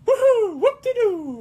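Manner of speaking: high, loud cartoon plumber whoop; Mario. Cheering for stream viewers, high loud Mario whoop.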